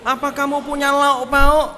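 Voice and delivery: very high, loud mocking sing-song; taunting question. Imagined spiteful Jesus taunting the fishermen, loud and high. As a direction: tease them for catching nothing.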